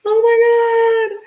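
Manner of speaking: loud, stretched squeal of dismay; high-pitched wail. Real, not acted. Exasperated at needing the water bottle.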